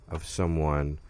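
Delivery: ordinary talk, nothing marked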